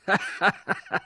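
high, loud cackle; mocking laugh. A narrator's reading. Crucified man mocks fear of hell, mocking laugh. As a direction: burst into derisive laughter.

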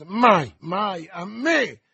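loud, drawn-out cry of refusal; vehement, ringing refusal; defiant shout. A narrator's reading. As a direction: shout it in outrage, stretching the words. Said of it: "gridò il duca".

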